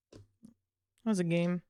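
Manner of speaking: normal talking voice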